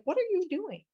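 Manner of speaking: high-pitched baffled protest; mock exasperation. Lawyer to angry wife collecting artwork, high mock exasperation.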